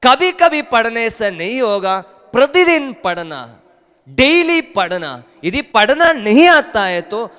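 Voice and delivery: loud, high-pitched preaching cry; full-force preaching. Acted. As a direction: preach it out high and forceful, drawing words long. (ज़ोरदार, ऊँचे सुर में उपदेश)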